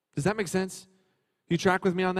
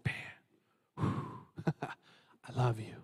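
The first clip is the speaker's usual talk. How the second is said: tender, quiet Father; 6 semitones lower, 12 dB quieter, much slower than usual. Acted. The Father speaks to Jesus.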